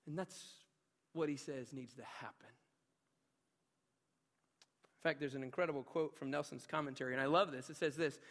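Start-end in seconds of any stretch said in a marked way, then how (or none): none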